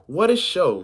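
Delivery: mild narrated shout; boy's shout. A narrator's reading. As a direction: call it out like a boy. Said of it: "shouted a boy".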